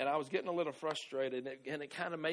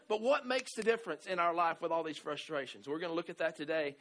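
usual speaking voice

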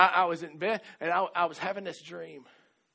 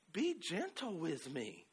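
soft, slow and kind, much softer; gentle kind plea; 9 dB quieter, much slower than usual. Retold or imitated. Wife asking her rough husband softly to be gentle. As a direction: ask tenderly, almost coaxing. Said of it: "in kind of a high pitch, almost kind, a nice way".

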